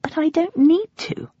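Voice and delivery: put-on high, pleading girl's voice; a daughter whining back at her dad; whiny protest. A narrator's reading. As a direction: object like a sulky teen.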